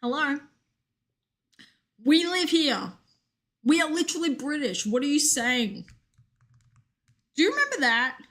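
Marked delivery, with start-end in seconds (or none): 0.0-5.8 higher, indignant mock British accent; British retort. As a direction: protest as a baffled British local. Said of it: "were like".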